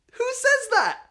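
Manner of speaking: very high incredulous squeal; gleeful who-says-that; delighted disbelief. Real, not acted. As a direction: marvel at it in amazement.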